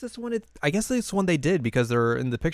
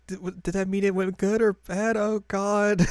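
flustered teacher; 8 semitones higher than usual. Retold or imitated. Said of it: "Miss Yamanaka's like".

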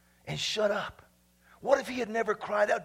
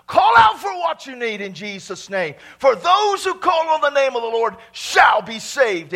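loud preaching surge; forceful exhortation; 3 semitones higher, 9 dB louder than usual. Real, not acted. Preacher urging the congregation to call out, forceful and loud. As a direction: drive it home at full volume.

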